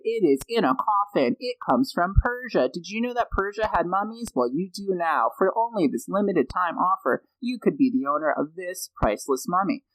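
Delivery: mock Billy Mays OxyClean pitch; infomercial pitchman. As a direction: sell the mummy like a late-night infomercial. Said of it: "the equivalent of, like, uh, a Billy Mays".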